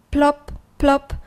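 two separate plops, like falling drops; dripping plop. A narrator's reading. Rainbow tears dropping into paint pots, plopping sound.

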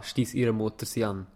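usual speaking voice

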